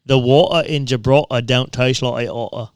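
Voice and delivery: loud hammed-up Cockney accent; mock Cockney accent. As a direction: shout it in an over-the-top Cockney accent. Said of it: "I can do a good Cockney accent".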